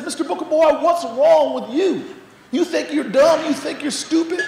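high, loud mock-scolding teacher; exasperated Southern teacher. Teacher challenging the boy who stood up, acted exasperation. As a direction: confront the pupil in a put-on Southern accent. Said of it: "the woman looks at him and says".

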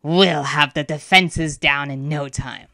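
slow, menacing creature voice; growling tiger. A narrator's reading. Tiger spirit threatening the school, menacing growl. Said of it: "the tiger growled".

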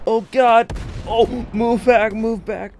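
loud, high panicked yell; panicked shout. Real, not acted. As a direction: warn the squad to pull back. Artillery incoming, warning squad.

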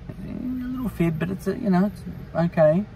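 tiny high-pitched voice, minimizing it; coy excuse. Acted. Excusing the writer's little fib.

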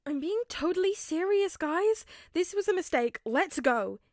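high, loud, anxious plea; scared plea. A narrator's reading. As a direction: beg them to leave. Ned scared, wants out.

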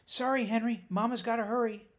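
loud, high call across the room; calling over shoulder. A narrator's reading. Owner apologising to her dog, loud hurried call. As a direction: call out in a rush. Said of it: "calling over her shoulder".

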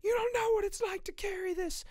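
high, loud wailing cry; Ricky breaks down in tears to his girlfriend. Acted. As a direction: sob it out in anguish. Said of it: "he's like".

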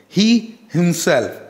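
"He himself" slow, each word weighted; slow, deliberate emphasis on "He himself"; stress on He himself. Shown as a demonstration. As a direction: draw out "He", then "himself" falling. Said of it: "giving a proper stress on the sentence".

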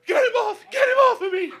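very high, full-volume panicked scream. Retold or imitated. Traveler screaming to get the dog off, panicked. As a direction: scream for help in comic terror. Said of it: "I'm, I'm screaming".

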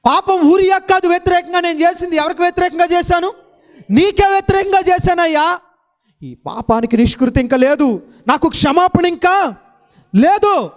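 high, loud climactic preaching; preaching at full force. Acted. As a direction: build the sermon to a ringing peak. (ఉద్రేకంతో బోధించే స్వరం)

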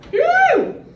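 loud, high playful woo; high whoop. Shown as a demonstration. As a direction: let out a quick whoop. Whoops during playful swaying.

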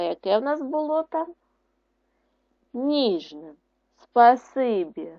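ordinary delivery

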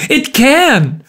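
high, vehement outburst; cried out. A narrator's reading. Markheim contradicting the visitor, crying out. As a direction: cry it out, pitch leaping up. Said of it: "cried Markham".

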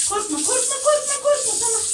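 rising high sing-song reassurance; calm the frightened cat with a high coo; soothing coo. Real, not acted. Soothing her frightened cat in the bath, high coo.

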